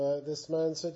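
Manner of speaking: normal talking voice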